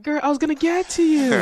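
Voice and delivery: high, loud playful call-out; excited. Real, not acted. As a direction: tease her with a big high voice. Teasing co-host, loud high.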